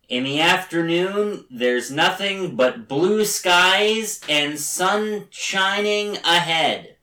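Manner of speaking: imitating David Lynch reading the weather, drawn out. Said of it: "my David Lynch impression".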